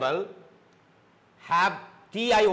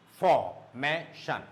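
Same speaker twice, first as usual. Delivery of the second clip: formation slow and low, for, may, shun apart; syllable by syllable; 4 semitones lower, much slower than usual. Shown as a demonstration. Teacher isolating the syllable before shun, syllable by syllable. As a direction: pause between each beat of formation. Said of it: "three syllables".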